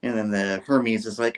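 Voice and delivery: ordinary delivery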